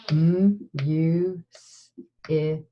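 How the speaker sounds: M, U, S, I, K, each sound split apart; sounding out words. Shown as a demonstration. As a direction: segment music into M, U, S, I, K. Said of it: "next sound in music".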